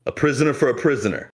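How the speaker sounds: lightly voiced captain, high and quick; pirate captain. Captain stating the bargain to Ramses, lightly voiced captain. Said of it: "the captain points and goes".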